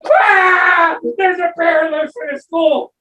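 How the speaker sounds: high frantic alarm yelled out; panicked shout. Acted. Teacher shouting the bear alarm again.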